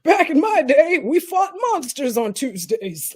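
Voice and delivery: loud, high start slowing into a boast; mock old-timer. Teasing a friend from another era, mock old-timer boast. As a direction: play the old-timer bragging about the past.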